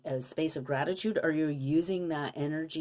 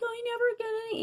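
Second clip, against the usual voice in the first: put-on high, whining wounded-child voice; whiny victim voice; faster than usual. Mocking how we talk in victim mode, high whine. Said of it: "and we use that tone".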